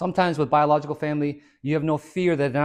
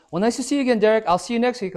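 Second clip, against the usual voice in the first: higher, sing-song fake-friendly goodbye; mock draining acquaintance; 7 semitones higher, 4 dB louder, faster than usual. Energy vampire saying goodbye to Derek, higher sing-song. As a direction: play the clingy acquaintance.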